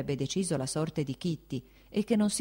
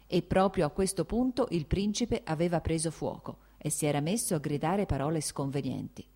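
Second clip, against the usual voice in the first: ordinary delivery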